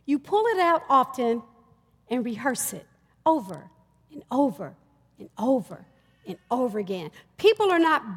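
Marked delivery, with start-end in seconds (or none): 2.1-7.1 over and over said softer and lower each time; preacher trailing off for effect; fading repetition. Real, not acted. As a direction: let each over fade away.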